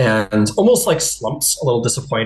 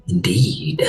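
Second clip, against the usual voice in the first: low, unvoiced rasp of a suspicious mind-creature; whispery alien creature; 5 semitones lower than usual. Captor confronting the Golden One, cold whisper. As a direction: hiss it out cold and accusing.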